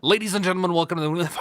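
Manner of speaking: mock-excited showman welcome; announcer intro. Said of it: "I need to be excited".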